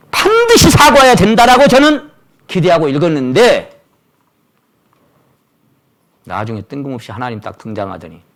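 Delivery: ordinary delivery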